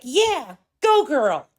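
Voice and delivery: high-pitched mock cheer; mock cheerleader. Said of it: "and be like".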